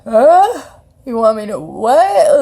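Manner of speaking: high, loud incredulous huh; stunned disbelief. Retold or imitated. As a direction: act floored by the request. Stunned by grandma's sudden demand.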